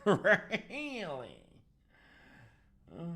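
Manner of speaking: high, loud, laughing, stretched-out "really"; Ace Ventura. Acted. Painter mimicking a film comic, high loud drawl. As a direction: go over the top, shriek it up and slide down. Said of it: "like Ace Ventura".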